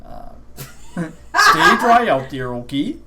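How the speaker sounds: slow, drawn-out Canadian farewell with a laugh; Canadian accent. Acted. Shopkeeper sends the customer off in a Canadian accent. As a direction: hold out dry in a friendly Canadian way. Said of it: "That's such a good accent".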